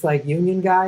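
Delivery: ordinary talk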